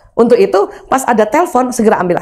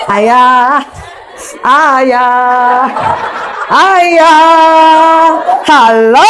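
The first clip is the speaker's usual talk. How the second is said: sing-song calling out to husband, louder each time; calling sweetly; 4 dB louder, much slower than usual. Acted. Wife calling her husband, sweetly calling out. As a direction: call him again and again, playfully.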